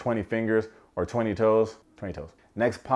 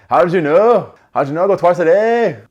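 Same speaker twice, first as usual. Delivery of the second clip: much higher, loud squeaky mock Freddie; Freddie impression; 9 semitones higher, 13 dB louder than usual. Vlogger mocks Freddie's gym reveal in a loud, high squeaky voice. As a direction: squeal it like an amazed gym guy. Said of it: "everything he says sounds like a question".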